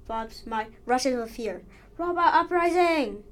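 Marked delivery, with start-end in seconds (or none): 2.0-3.2 high, loud alarmed cry; shouting. Acted. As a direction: yell the warning. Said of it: "he shouted".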